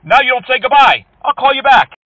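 loud, high indignant yell; angry shout. Acted. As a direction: blow up at the fake agent. Caller yelling at fake support.

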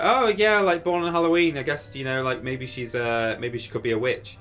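put-on high, loud eager young self; excited child. Kid asking grandma, high and eager. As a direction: gush it out like a curious kid.

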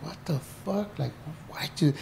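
muttered shock at the place; quiet disbelief. Retold or imitated. New inmate arriving at Folsom, hushed disbelief. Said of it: "I was like".

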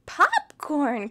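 loud excited cry; cried out. A narrator's reading. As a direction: burst out with delight. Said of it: "cried Dexter".

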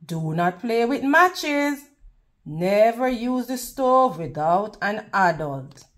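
plain reading voice

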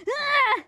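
short, high, loud yell; frustrated yell. Real, not acted. Streamer who missed a viewer's message, frustrated yell.

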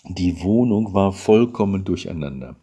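ordinary delivery, nothing marked

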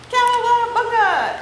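high, loud, drawn-out cheer; cowabunga whoop. Acted. Crayon splashing into the puddle, loud whoop. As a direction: yell it as you jump in.

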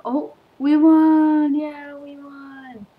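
high, slow, drawn-out cheer; elated victory cry. Real, not acted. Player winning mid-sentence, elated victory cry.

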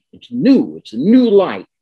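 "new" swung high and loud both times; stress on new. Shown as a demonstration. Lecturer explaining the power of those words, emphatic stress on "new". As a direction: punch "new" with a big lift in pitch and volume. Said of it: "wanted to stress the word new".

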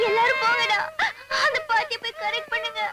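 sobbing through the words; crying. Acted. Crying child sending the others away, sobbing. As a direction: plead through tears. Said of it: "உட்கார்ந்து அழுதுட்டு இருக்கு".

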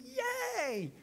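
high, joyful yelp; jubilant shout. Retold or imitated. Pastor acting George cheering at his wrecked car, high joyful yelp. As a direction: cheer like a man reborn.

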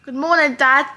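loud call across the room. Real, not acted. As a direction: call it out to Dad. Calling out to her dad.

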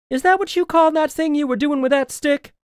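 high, teasing scornful voice; mocking. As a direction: laugh off his swordplay. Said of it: "She laughed".

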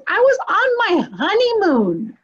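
a hint of an excited shaman; lightly voiced shaman. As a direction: play up the shaman bragging about his honeymoon. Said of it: "He goes".

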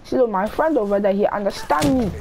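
a hint of a Nigerian accent, arguing back; lightly played Nigerian gamer trading insults; put-on Nigerian accent. Troll trading insults with teammates, mock Nigerian accent. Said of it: "I'm from Nigeria".

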